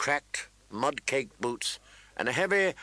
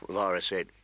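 ordinary delivery, nothing marked

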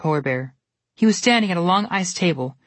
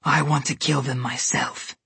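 icy threat; 3 semitones lower than usual. A narrator's reading. Snow Queen claiming the kill for herself, low and menacing. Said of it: "she ordered".

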